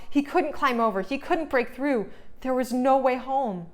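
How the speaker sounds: ordinary delivery, nothing marked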